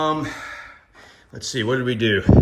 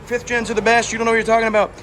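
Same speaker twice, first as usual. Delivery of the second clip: higher, louder mocking know-it-all commenter; 10 semitones higher, 8 dB louder, faster than usual. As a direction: parody an angry internet commenter. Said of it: "before I get comments".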